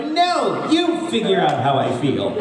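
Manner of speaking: high, whiny mock demand; pouting half-laughing impersonation of anime characters; mock petulant anime character. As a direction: huff it like a sulky anime character. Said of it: "Everyone's like".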